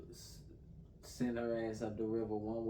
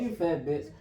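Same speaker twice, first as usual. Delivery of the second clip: raised, louder retold name-calling; mock insult; 5 semitones higher, 6 dB louder, slower than usual. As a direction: throw the mimicked insult out high and loud.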